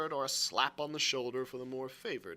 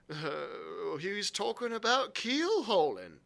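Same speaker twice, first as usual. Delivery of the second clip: higher, hesitant sailor's voice; questioned sailor; 6 semitones higher, slower than usual. Acted. Morgan answers Long John's questioning, hesitant. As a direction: answer reluctantly, pitched up.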